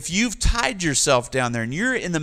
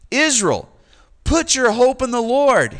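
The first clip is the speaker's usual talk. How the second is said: raised, louder call to Israel; man on the peak calling Israel, raised and loud; 7 semitones higher, 6 dB louder than usual. Acted. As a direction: call the people to hope from the peak. Psalmist calls out to Israel.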